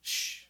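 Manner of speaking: a soft hushing shh; shushing. Acted. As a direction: hush him.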